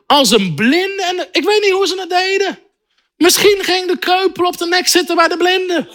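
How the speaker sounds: high-pitched, incredulous challenge; animated preaching. Real, not acted. Preacher challenging listeners with blind and lame climbers, animated. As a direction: build the comic argument with fervour.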